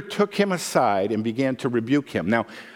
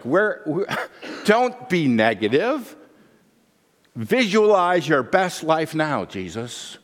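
chipper Peter; 4 semitones higher than usual. Acted. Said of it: "Peter's like".

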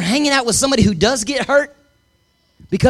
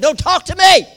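sudden high shout, much louder; angry yell; 10 semitones higher, 5 dB louder than usual. Retold or imitated. Husband yelling at wife to leave him alone. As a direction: snap it out like a furious husband.

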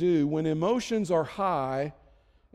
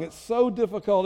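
usual speaking voice

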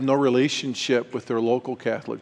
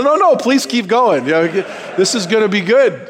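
eager urging; 8 semitones higher, 6 dB louder than usual. Retold or imitated. Urging friend to keep talking.

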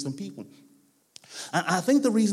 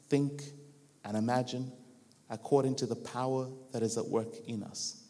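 solemn scripture reading; 4 semitones lower, 4 dB quieter than usual. Real, not acted. Preacher reads Ephesians to the congregation, solemn and measured. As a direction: read it as a hushed benediction, word by word.

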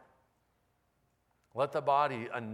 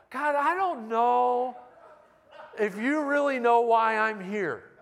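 laughing Moses; 7 semitones higher, 5 dB louder than usual. Retold or imitated. Moses protesting to God.

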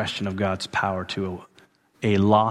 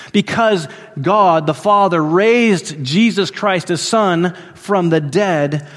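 slow proclamation; 7 semitones higher, 5 dB louder than usual. Acted. Slowed proclamation of the resurrection.

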